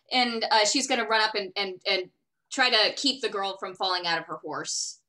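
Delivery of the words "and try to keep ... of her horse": normal talking voice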